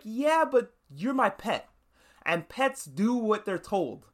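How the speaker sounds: imitating a boss patronising her pet, slowly; condescending owner. Madame Vought putting Homelander down.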